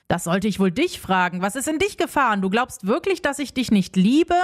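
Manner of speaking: ordinary delivery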